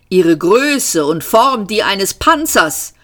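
ordinary delivery

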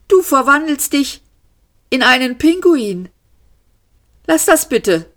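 high, pleading character voice; bewildered Arthur. A narrator's reading. Arthur to Ford turning into a penguin, high and bewildered.

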